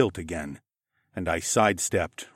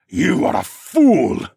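loud, slow, higher roar; furious cry; 6 semitones higher, 8 dB louder, slower than usual. A narrator's reading. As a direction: roar the insult down at him. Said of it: "Siskorn cried out".

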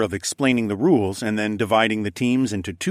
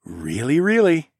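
playful reassurance; 6 semitones higher than usual. A narrator's reading. Narrator reassuring a toddler while tapping her nose, playful. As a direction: promise it playfully to a child. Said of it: "I replied".

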